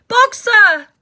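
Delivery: loud high anguished cry; terrible cry. Acted. Clover at the van window, anguished cry. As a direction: scream his name in anguish. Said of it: "cried Clover in a terrible voice".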